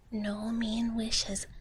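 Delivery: ordinary delivery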